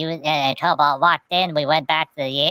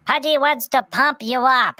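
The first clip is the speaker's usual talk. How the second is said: higher, eager little hedgehog voice; lightly voiced cute hedgehog, pitched up; Pudgy voice; 9 semitones higher than usual. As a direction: chirp it up like a tiny hedgehog. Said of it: "and Pudgy's like".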